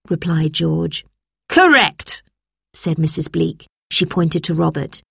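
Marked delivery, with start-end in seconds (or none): 1.5-2.2 high-pitched, emphatic confirmation; stern teacher. A narrator's reading. Teacher marks George's answer, high and emphatic.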